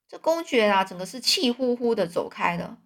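usual speaking voice

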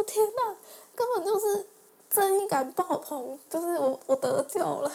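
squealing with relief as the landlord steps in; overjoyed relief. Retold or imitated. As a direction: gush it out like you've just been saved. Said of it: "然后我就想说：".

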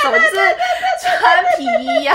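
high, loud laugh through the words. Real, not acted. To her co-host about the silly spy scenes, laughing loudly. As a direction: burst out laughing while listing the silly scenes.

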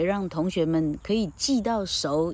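ordinary talk, nothing marked

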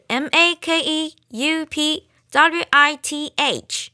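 letters spelled out one by one, slowing; spelling it out. Shown as a demonstration. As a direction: spell each letter slowly and clearly. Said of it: "那怎么拼呢".

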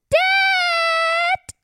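put-on high, drawn-out child's shout; calling for Dad. Little princess calling Dad from bed, shouting. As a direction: yell for your father as a little princess.

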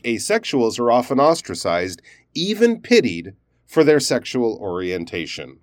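even pitied stressed, with a pause on either side; pause, lean on even pitied, pause, then finish. Shown as a demonstration. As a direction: set even pitied in its own comma bracket. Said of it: "stress the word pity and maybe even have a pause".

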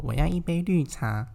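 slow, lightly put-on baby-talk voice; a hint of a cutesy girl ordering. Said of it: "超级无敌娃娃音的声音说". (轻轻模仿的娃娃音)

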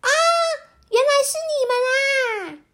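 bright relieved cry; high surprised exclamation; surprised high child voice. Acted.

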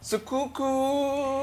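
"是哭哭" drawn out very slowly; slow spelling out. Shown as a demonstration. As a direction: stretch every syllable of "哭哭" to correct him. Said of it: "你写的是幕布的哭". (非常缓慢地拉长说"哭哭")

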